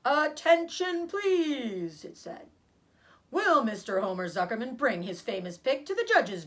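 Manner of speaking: a formal public address boomed out; loudspeaker announcement. Acted.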